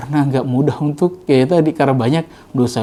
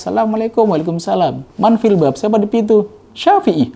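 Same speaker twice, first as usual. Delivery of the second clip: doorstep exchange; 8 semitones higher than usual. Retold or imitated. Knocking at door dialogue.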